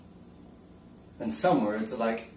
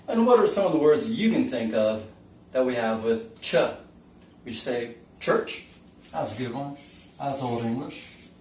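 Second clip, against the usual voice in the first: usual speaking voice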